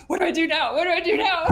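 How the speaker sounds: high, loud mock panic; hammed-up panic, high and loud; panicked client. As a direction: freak out like a flustered client. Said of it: "They're like".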